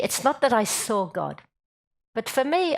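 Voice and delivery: usual speaking voice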